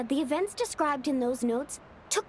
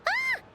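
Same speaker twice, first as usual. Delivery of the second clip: short, very high-pitched shriek; a sudden high cry of fright; startled scream. Acted. Paimon startles friends over the changed photo, high scream. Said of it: "Why'd you scream like that?".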